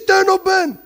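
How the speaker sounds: high, loud and punchy; loud warning. Retold or imitated. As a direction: bark the slogan out. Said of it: "he says".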